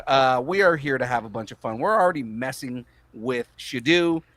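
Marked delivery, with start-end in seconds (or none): none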